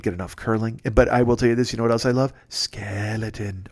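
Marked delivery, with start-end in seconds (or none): 2.5-3.7 dramatic announcer. Acted.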